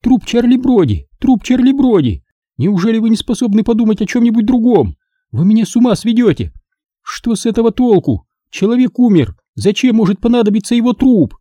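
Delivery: high, fast outraged shrieking; woman losing it at the detective's obsession; hysterical. A narrator's reading. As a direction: rant at him in exasperation. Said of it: "взвизгнула она".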